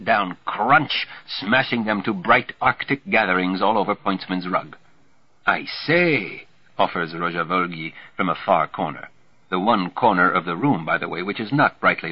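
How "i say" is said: higher-pitched, lightly voiced bystander; tentative interjection. A narrator's reading. Roger Volgi from a far corner, tentative. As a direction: pipe up from the sidelines.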